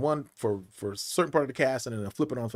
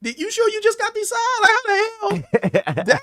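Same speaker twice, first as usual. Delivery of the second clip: high, loud mock astonishment; amazed; 8 dB louder than usual. Crew reacting to the actor's scene, amazed. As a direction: imitate a bowled-over crew at top pitch.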